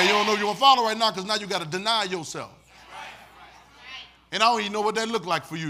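ordinary talk

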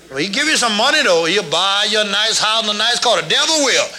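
full-force preaching. Real, not acted. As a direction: preach it hard, warning the church. Preaching at full force about devil.

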